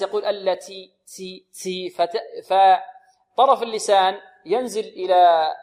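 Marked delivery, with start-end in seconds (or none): 0.2-1.9 "التي" with a hissy "tsi" for the ta; exaggerated hams. Shown as a demonstration. Teacher imitating students' error, over-aspirated ta. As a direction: overdo the breath on "تي" until it sounds like s. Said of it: "يبالغون في الهمس".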